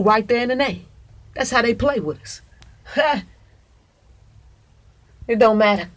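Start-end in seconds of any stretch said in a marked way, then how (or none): 2.9-3.2 mock laugh. Mocking the plotters' laughter.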